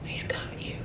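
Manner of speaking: soft unvoiced whisper. Comforting a crying girl at the gas station, whispered. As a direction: whisper it close to her ear. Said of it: "I whispered in her ear".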